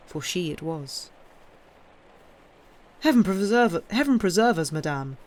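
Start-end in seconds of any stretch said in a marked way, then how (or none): 3.0-5.2 loud, startled cry of alarm; alarmed exclamation. A narrator's reading. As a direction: burst out in shock. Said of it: "he exclaimed".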